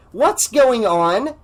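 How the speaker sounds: loud, high, slow demand. A narrator's reading. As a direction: insist on an answer. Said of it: "Sandra demanded".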